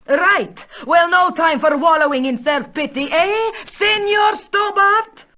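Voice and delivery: rally with sudden brisk vigour; rousing resolve. Acted. Countess to Stobart, done with self-pity, rousing.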